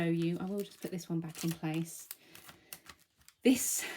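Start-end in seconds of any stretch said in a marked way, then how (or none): 3.4-3.8 breathless after talking; short of breath, gasping slightly. Real, not acted. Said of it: "sorry, I'm really out of breath".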